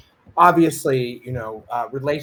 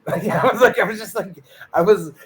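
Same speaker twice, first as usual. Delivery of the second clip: laughing through the words; 6 semitones higher, 6 dB louder than usual. Real, not acted. Laughing while retelling his own reaction to Amir. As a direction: chuckle while you say it.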